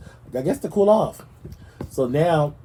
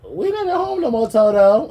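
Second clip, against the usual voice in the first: much higher, wistful Dorothy voice; high, lightly voiced Dorothy lament; Dorothy quote; 11 semitones higher, 5 dB louder than usual. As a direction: play Dorothy lost in Oz. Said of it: "I was like".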